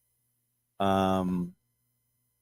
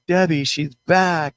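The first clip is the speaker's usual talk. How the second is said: high, loud and drawn out, suddenly alarmed; startled cry; 9 semitones higher, 5 dB louder, slower than usual. Retold or imitated. To her sister Debbie at the bedside, a slow startled high cry. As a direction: call out slowly in shock as if she just came back. Mother suddenly breathes again.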